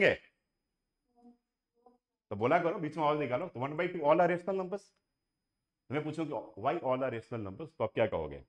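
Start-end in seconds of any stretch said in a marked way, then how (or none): none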